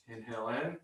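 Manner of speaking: low, soft breath cues with long "ah"; guided breathing meditation. Shown as a demonstration. Yoga teacher guiding breaths and saying "ah", low and soft. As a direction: lead the breath softly and low, sighing out a long "ah".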